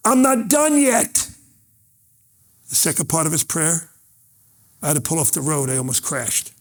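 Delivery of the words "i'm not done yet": put-on higher, slow, earnest voice of the boy; young man insisting. Davis stopping the high five mid-prayer, earnest. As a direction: gently insist you're not finished. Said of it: "He goes".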